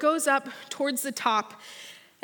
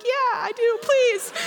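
eager yes; 10 semitones higher, 4 dB louder, slower than usual. Retold or imitated. Eager reply to ride operator.

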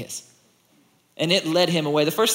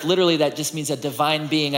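normal talking voice

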